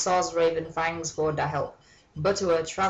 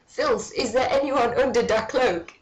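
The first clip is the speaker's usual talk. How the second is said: janitor voice; 6 semitones higher, 3 dB louder than usual. A narrator's reading. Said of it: "he asked".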